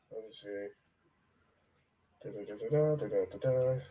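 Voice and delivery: usual speaking voice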